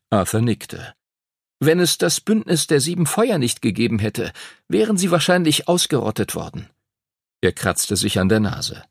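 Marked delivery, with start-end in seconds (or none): 1.6-6.7 higher-pitched schoolboy voice; serious young pupil, pitched up; earnest know-it-all pupil. Acted. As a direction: explain history as a studious boy. Arthur reflects on history.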